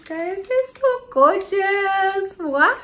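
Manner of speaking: high, loud, slow gush; gushing excitement. Real, not acted. Vlogger to viewers, admiring the shoes, gushing. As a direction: gush over them.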